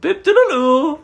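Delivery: high, loud mock British accent, laughing; hammed-up British accent through giggles. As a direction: squeak out a silly British nonsense phrase. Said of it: "What about your British accent?".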